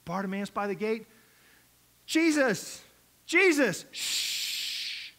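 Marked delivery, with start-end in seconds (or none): 2.0-3.8 high, loud cry for attention; acted beggar's call. Bartimaeus calling from the gate. 3.9-5.1 long, voiceless shush; sustained silencing noise; hushing shush. Acted. Crowd hushing the caller.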